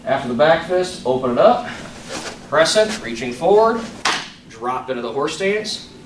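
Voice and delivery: crisp called-out form commands; sharp martial arts commands. Shown as a demonstration. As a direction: call each move like a drill. Calls form movements over music.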